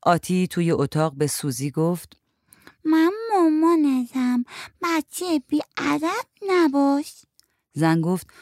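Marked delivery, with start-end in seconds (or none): 2.9-7.1 put-on high, slow little-kid voice; a small child scolding a doll; child playing mother. A narrator's reading. (صدای نازک بچه در نقش مامان)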